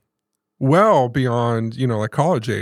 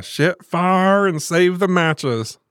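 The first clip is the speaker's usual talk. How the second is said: Grandma's Southern saying; 5 semitones higher, much slower than usual. Retold or imitated. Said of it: "She used to always say that".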